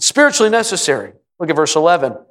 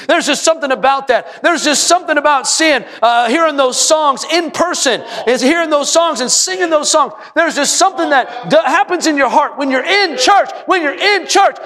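rising preaching; 8 semitones higher than usual. Real, not acted. Preacher building his congregation to a fervent shout.

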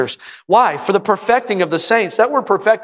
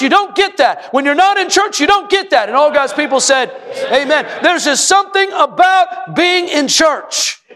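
rising preaching; 8 semitones higher than usual. Real, not acted. Preacher building his congregation to a fervent shout.

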